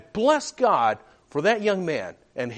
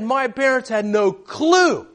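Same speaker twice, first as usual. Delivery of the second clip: emphatic preaching; 5 semitones higher, 5 dB louder than usual. Real, not acted. Preacher stresses hidden sin.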